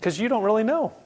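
high-pitched, taunting devil's retort; devil talking back. Acted. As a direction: play an unimpressed devil refusing to budge. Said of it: "he's like".

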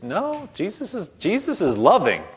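ordinary talk, nothing marked

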